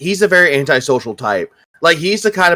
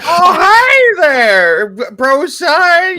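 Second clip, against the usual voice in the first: high, slow cartoon voice; squeaky nervous cartoon sidekick; Krillin voice; 12 semitones higher, much slower than usual. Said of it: "their form of Krillin".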